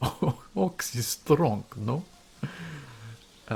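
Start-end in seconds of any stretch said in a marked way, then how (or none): 0.0-2.0 slow, louder imitation of the driver's Russian accent; mock Russian accent. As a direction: ham up the Russian accent while laughing. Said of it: "butchering the accent".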